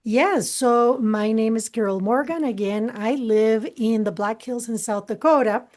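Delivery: usual speaking voice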